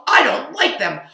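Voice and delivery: high, loud upset cow; a child's cow voice getting worked up; loud fretful farm cow. Acted.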